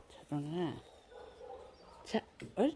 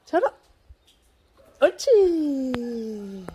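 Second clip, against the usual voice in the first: talking to a dog; 3 semitones higher, 8 dB louder than usual. Real, not acted. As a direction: stretch it out for the dog. Praising dog slowly.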